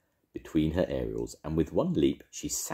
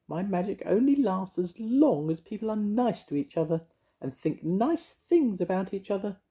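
high, bossy witch warning; a little witch laying down the rule; 4 dB louder than usual. Acted. Mavis voiced.

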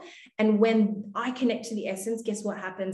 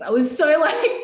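laughing; 7 semitones higher, 8 dB louder than usual. Real, not acted. Laughing at herself telling story.

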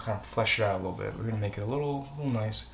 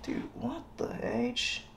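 shocked; 13 semitones higher, 7 dB quieter, slower than usual. Real, not acted. Shocked at paint mistake.

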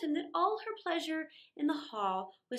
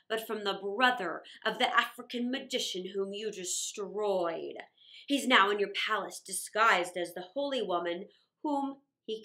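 lower, loud and grave; warning genie; 5 semitones lower, 4 dB louder than usual. Acted. Genie warning Aladdin of the magician, grave and low. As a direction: warn him darkly of danger.

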